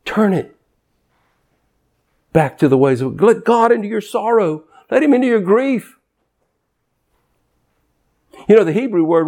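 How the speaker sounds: normal talking voice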